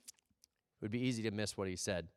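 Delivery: ordinary talk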